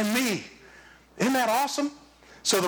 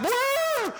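mock astonishment; 14 semitones higher than usual. Real, not acted. Reacting to kids' phone habits.

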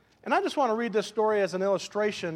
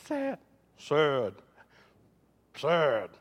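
hammed-up, drawn-out mock gloom; attempted sad; 3 semitones lower, much slower than usual. Preacher who can't be sad today trying anyway, mock sad. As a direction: keep trying out a sad voice, unconvincingly. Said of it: "I'm trying to be sad".

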